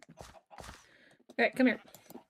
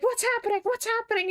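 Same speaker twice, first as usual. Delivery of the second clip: high, anxious repeated question from mom; mom's alarmed question; faster than usual. Retold or imitated. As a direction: voice the mom, worried and confused. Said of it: "And my mom is like".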